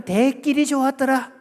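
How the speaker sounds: high-pitched Gyeongsang exclamation; Gyeongsang dialect. Shown as a demonstration. Preacher gives second Gyeongsang version, high Gyeongsang cheer. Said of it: "경상도 버전입니다".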